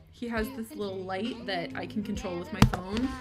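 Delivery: ordinary talk, nothing marked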